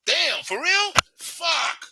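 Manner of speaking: mock shocked cursing; stunned outburst. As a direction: react to shocking news. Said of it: "you like".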